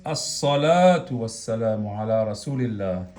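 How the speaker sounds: "As-salatu" with the article merged into a held s; fused lam. Shown as a demonstration. Teacher adding alif lam to salat, lam fused into sad. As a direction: double the s in "As-salatu", no L. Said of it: "Sumamos alif lam y decimos".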